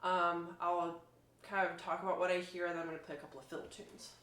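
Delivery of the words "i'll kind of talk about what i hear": normal talking voice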